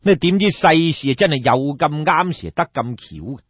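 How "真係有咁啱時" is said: ordinary delivery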